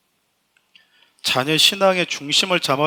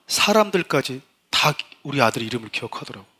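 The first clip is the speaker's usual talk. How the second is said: ordinary delivery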